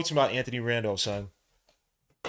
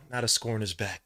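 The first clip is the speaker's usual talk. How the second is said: ordinary delivery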